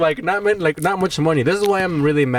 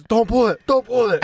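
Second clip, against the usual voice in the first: higher-pitched imitated scolding; mock pissed-off attendant; 7 semitones higher than usual. Attendant telling customer off over receipt, mock anger. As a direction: scold a customer, acted in retelling. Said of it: "He's pissed".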